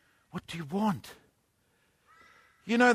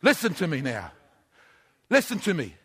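usual speaking voice

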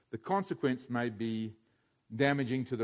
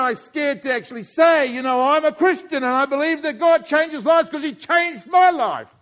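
raised, fervent preacher's voice; preaching at full force; 12 semitones higher, 12 dB louder than usual. Acted. Preacher rallying the congregation, fervent and raised. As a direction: preach it out to the whole room.

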